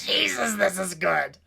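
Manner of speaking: loud, high burst of amused delight; laughing exclamation. Real, not acted. Listener enjoying the sinkhole story, laughing out loud.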